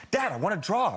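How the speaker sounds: put-on insistent child. Jet-lagged daughter wakes dad to draw, insistent child. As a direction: press the request like an impatient child.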